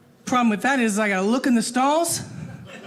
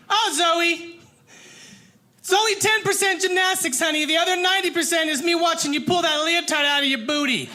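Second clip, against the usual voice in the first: high mom yell across the gym; yelling back; 6 semitones higher than usual. Acted. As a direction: holler back at your kid, pitched up. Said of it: "I just yelled back".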